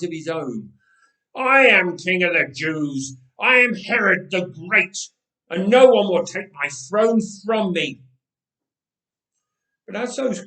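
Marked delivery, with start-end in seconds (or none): 1.3-8.0 much louder, higher raving; madman cries. Acted. Herod answers the ghosts' screams, madman shouting. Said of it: "madman cries".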